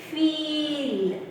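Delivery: "feel" with a drawn-out ee; slow model "feel", vowel held long. Shown as a demonstration. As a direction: stretch the middle of "feel" slowly. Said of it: "Where is the stress".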